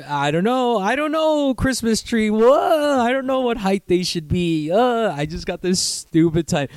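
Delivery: high, drawn-out clueless act; mock idiot. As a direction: play yourself as a dim-witted fool, slow and high. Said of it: "look like the fucking idiot".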